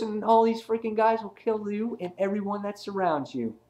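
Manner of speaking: high-pitched, insistent retold menace; acted villain threat.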